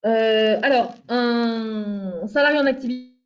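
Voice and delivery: faint, distant voice; far from mic. Real, not acted. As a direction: talk from far off the microphone. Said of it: "on ne t'entend plus".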